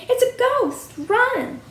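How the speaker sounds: high-pitched storybook panic; alarmed cry. A narrator's reading. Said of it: "cried Piglet".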